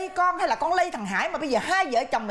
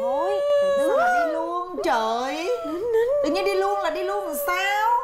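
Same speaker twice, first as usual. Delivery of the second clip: high, drawn-out cry of dismay; mother's high, wailing protest; 6 semitones higher, slower than usual. Acted. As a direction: stretch it out high in disbelief. Mother dismayed at daughter leaving.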